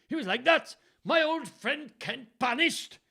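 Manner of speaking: very high, loud, hammed-up Welsh; Welsh accent. A narrator's reading. Reader voices Gloucester aghast at his friend's banishment, Welsh accent. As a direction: exclaim in a sing-song stage Welsh. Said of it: "He was Welsh, wasn't he?".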